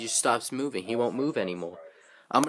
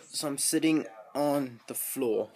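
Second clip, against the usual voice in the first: ordinary delivery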